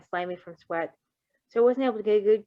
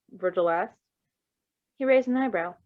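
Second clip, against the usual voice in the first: usual speaking voice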